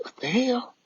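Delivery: high, startled "what the hell"; jolted awake and confused; startled confusion. Retold or imitated. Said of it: "I'm looking like".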